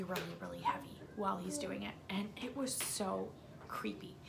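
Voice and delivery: soft unvoiced whisper; creepy whisper. Real, not acted. Describing the creepy cowboy, whispered. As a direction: hush it like a spooky secret.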